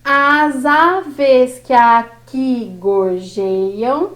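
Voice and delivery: very slow, syllables drawn apart; teacher scanning, slowly; slow scansion. Shown as a demonstration. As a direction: stretch out every syllable of "As aves que aqui gorjeiam". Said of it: "Vamos para o próximo verso".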